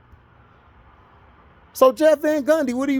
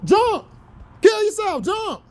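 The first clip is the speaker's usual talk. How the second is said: mock principal taunt; 8 semitones higher than usual. Quoting Lean On Me, principal daring Sams, hammed-up. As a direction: taunt him to the edge. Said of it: "What did my boy say on Lean On Me?".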